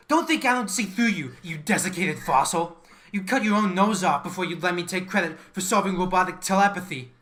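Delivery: loud, heated accusation, rising; angry outburst. Acted. Employee shouting down his boss, furious rant. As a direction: tell the old man off in fury.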